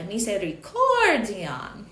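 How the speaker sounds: Misericordiam drawn out slowly, with a big swell on the COR; over-exaggerate the stressed COR in Misericordiam, stretching it long; over-exaggerated accent. Shown as a demonstration. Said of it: "I'll over-exaggerate it once".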